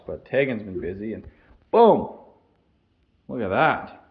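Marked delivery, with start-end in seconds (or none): 1.7-4.0 excited reveal. Real, not acted. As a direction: announce the reveal with a flourish. Revealing painted wall.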